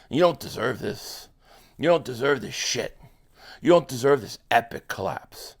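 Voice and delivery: low, hushed consolation; consoling fans. Real, not acted. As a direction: soothe the heartbroken fans gently. Consoling fellow Eagles fans.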